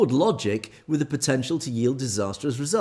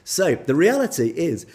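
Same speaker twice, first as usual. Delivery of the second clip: ordinary talk, nothing marked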